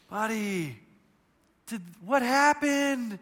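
slow, cooing concern; tender father. Retold or imitated. Father asking his hurt son what happened, tender. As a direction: draw it out soothingly for a small child. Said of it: "I go like this".

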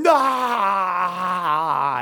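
short hammed-up scream of despair; a quick fake anguished cry; mock scream. Mock scream after election.

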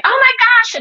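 high, loud burst of delight at a new leaf; delighted gasp. Retold or imitated. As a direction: squeal it like you just spotted a new leaf.

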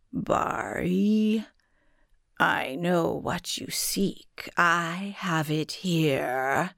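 very slow, otherworldly guardian; eerie spirit voice. A narrator's reading. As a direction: speak like an ancient spirit.

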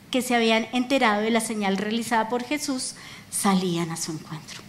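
usual speaking voice

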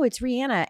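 [name] said Ree-ANN-uh; insisted pronunciation. Shown as a demonstration. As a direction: correct it to [name] with "ann" in the middle. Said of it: "It's not Riri?".

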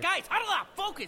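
high, loud call to the team; calling huddle. Acted. Quarterback calls team into huddle, high shout. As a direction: yell them back together.

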